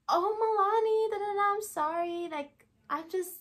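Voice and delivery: high-pitched gushing apology, mimicked; imitating Emily. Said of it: "this is how she talks".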